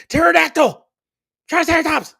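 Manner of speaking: high excited shout. Retold or imitated. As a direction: yell it like a Power Ranger. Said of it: "I would be like".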